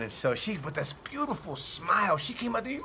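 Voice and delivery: ordinary delivery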